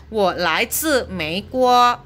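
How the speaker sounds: ordinary talk, nothing marked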